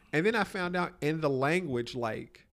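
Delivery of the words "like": ordinary delivery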